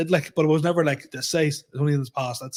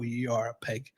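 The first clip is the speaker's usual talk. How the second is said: self-disgust; 5 semitones lower, 10 dB quieter than usual. Retold or imitated. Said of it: "look at myself and go".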